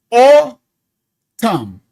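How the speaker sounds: "Autumn" loud and stretched into AU-tum, both beats heard; syllables drawn out. Shown as a demonstration. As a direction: pull "Autumn" apart into two loud beats. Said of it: "Autumn has two syllables".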